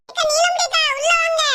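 put-on high girlish call to come inside; daughter calling the neighbour. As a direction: welcome the neighbour in the young daughter's voice.

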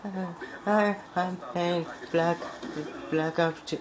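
hammed-up heartbroken whine; mock sad gamer. Dumped gamer moping to viewers, high whiny mock sadness. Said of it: "be like".

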